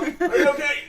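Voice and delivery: high, loud worried call; high concerned gnome. Acted. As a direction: call out to check on her. Gnomes calling to dizzy friend.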